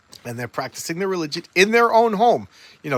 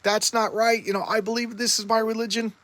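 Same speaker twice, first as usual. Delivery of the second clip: high-pitched imitated complaint; put-on hurt objection, up high; mock indignant protest; 8 semitones higher than usual. Witch objects to being teased, high mock protest.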